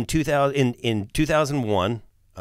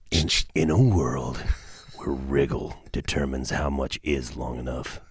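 movie trailer voice; 8 semitones lower than usual. Acted. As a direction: announce it like a film trailer. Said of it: "the movie voice".

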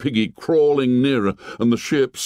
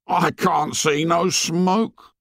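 put-on slow, high, disbelieving boy's voice; incredulous; 9 semitones higher, slower than usual. A narrator's reading. Piggy stares at the empty horizon, slow and incredulous. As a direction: drag it out in disbelief, pitched up. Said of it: "said Piggy incredulously".

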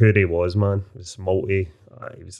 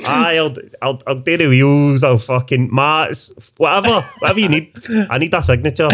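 higher, eager mock salesman patter; over-promising salesman; 5 semitones higher, 4 dB louder than usual. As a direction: hand out promises freely, pitched up, chasing the signature.

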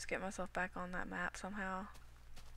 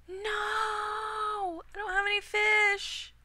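high, loud panicked cry; panicked shout; 12 semitones higher, 8 dB louder, much slower than usual. Real, not acted. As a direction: yell in sudden panic. Panic at missing fish.

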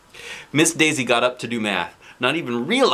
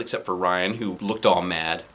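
plain reading voice

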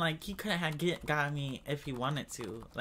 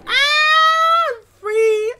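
high joyful shout; 19 dB louder, much slower than usual. Real, not acted. Escape relief.

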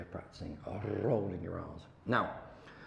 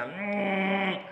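a buzzing hum, higher; head hum; 8 semitones higher than usual. Shown as a demonstration. Teacher letting Katie hear his head hum, higher hum. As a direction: hum so it rings in your head. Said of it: "you can hear me go".

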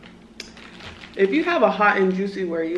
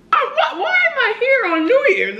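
high, baffled self-quote; bewildered high voice. Retold or imitated. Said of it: "is what I was asking".

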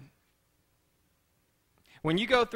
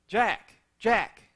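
lightly acted call from afar; mildly projected, higher calling voice; voice calling back; 5 semitones higher, 5 dB louder than usual. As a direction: call out a name, a little raised. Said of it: "a voice comes back and says".

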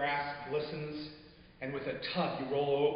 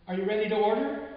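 waitress; 6 semitones higher, 5 dB louder, faster than usual. Acted. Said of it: "the waitress asks".

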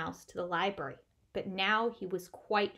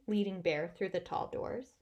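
ordinary delivery

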